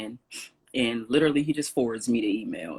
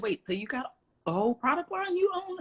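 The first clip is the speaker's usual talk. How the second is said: amazed dad. Retold or imitated. Dad amazed by her product line.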